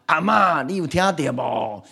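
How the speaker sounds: mock shouting into grandma's ear; calling to grandma. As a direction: call out to a hard-of-hearing grandma. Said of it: "讲话拢足大声，在耳讲啊".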